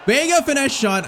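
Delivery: high-pitched, sudden commentary burst; excited shot call. Real, not acted. Takes a shot on goal.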